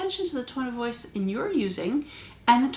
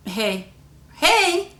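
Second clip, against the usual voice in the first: second "hey" higher and louder; tone change on hey; 7 semitones higher, 9 dB louder than usual. Shown as a demonstration. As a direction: punch the second "hey" up high and loud. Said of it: "That little bit of tone will make".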